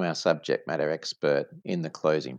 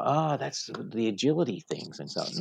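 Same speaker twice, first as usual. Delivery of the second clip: lightly voiced customer, a little higher; imitated guessing customer; 5 semitones higher, 3 dB quieter than usual. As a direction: play a customer having another guess. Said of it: "they think a little bit more".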